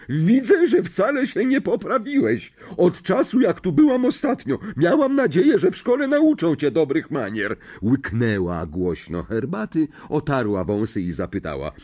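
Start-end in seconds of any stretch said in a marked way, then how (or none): 0.1-7.6 a big domineering aunt who loves her dog; bossy aunt. A narrator's reading. Marge lectures Harry. (władcza ciotka, wysoki głos)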